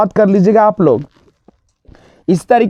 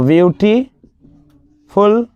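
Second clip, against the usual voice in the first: "more beautiful" with "beautiful" stretched out very slowly; slow model answer; much slower than usual. Shown as a demonstration. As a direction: drag out "beautiful" like dictation. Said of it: "comparative बनाते हैं तो more".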